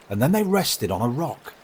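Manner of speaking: ordinary delivery, nothing marked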